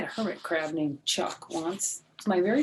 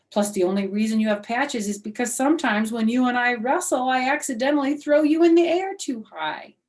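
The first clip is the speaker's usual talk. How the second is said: excited rambling; 5 semitones higher, 6 dB louder than usual. Acted. Excited kid explanation.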